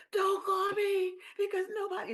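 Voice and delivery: very high mock bawl; imitating her bawling. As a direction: wail it in a high pitch like an upset caller. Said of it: "She's bawling on the phone".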